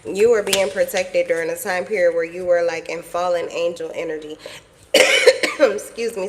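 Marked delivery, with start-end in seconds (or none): 4.9-5.5 sharp hacking cough; coughing fit. Real, not acted. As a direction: cough hard from a cold. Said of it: "Sorry for the coughing and hacking".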